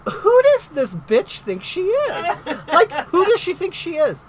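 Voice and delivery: high, loud, laughing disbelief; laughing outrage. Retold or imitated. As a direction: blurt it out through a laugh, outraged. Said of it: "and I'm like".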